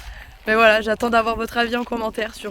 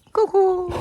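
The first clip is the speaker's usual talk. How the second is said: high-pitched greeting; 10 semitones higher, 5 dB louder than usual. Real, not acted.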